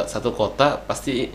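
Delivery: ordinary talk, nothing marked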